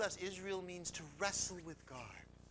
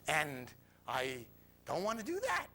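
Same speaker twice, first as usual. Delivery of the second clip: usual speaking voice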